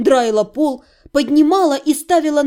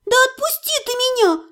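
gurgled through water, high; булькая из-под воды; underwater; 11 semitones higher than usual. A narrator's reading. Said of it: "пробулькала я". (пробулькать сквозь воду)

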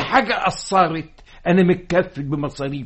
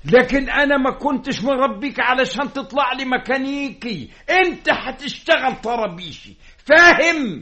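higher-pitched, insistent scolding; angry father; 7 semitones higher than usual. Acted. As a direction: lay down the law, rising to a sharp demand. Father scolding son over his trade. (بزعيق أب غاضب)

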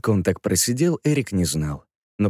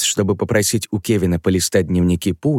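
usual speaking voice

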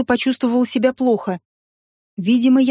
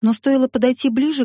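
ordinary delivery, nothing marked

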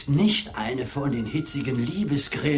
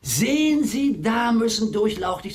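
exaggerated flattery; 8 semitones higher, 4 dB louder than usual. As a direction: sing it out to charm her. Wooer flatters the spinster.

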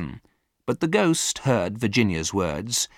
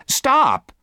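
high, loud outburst; sharp cry; 8 dB louder than usual. A narrator's reading. Virginia interrupting the ghost, sharp cry. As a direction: cry out to cut him off. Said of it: "cried Virginia".

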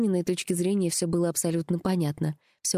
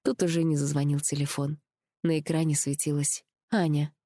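ordinary delivery

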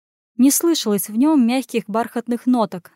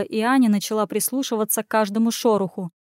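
plain reading voice

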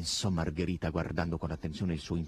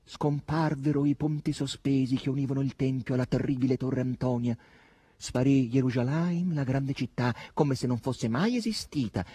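sustained, higher intoned declaiming; even, raised recital; solemn recitation; 5 semitones higher than usual. A narrator's reading. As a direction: intone it like a grave chronicle. Reciting the novel's text aloud.

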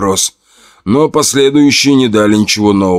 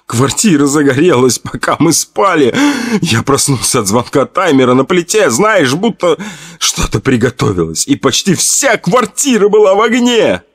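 high tearful panic; tearful, rising to panic; 7 semitones higher than usual. Acted. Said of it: "зарёванного Томаса".

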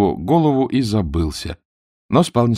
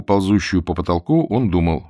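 usual speaking voice

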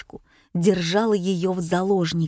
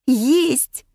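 higher, exultant little cry; triumphant exclamation; 5 semitones higher, 4 dB louder than usual. A narrator's reading. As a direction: cry out at a lucky find. Finds the passport, triumphant. (торжествующий возглас)

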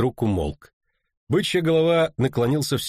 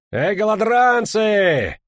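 high, loud, drawn-out holler; ringing roar; 8 semitones higher, 5 dB louder, much slower than usual. A narrator's reading. Knight to ragamuffins under a cart, ringing roar. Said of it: "звонко рыкнул один из рыцарей".

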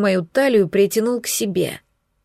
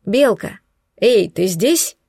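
slow, far-off call; distant voice; slower than usual. Acted. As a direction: sound muffled, heard through ringing ears. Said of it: "сквозь шум в ушах знакомый голос".

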